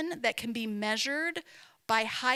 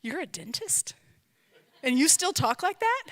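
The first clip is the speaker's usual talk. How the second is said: mock disbelief that jumps higher and louder on the second question; incredulous; 5 semitones higher, 5 dB louder than usual. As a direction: play your old self, stunned that a dentist sounds like that. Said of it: "just like".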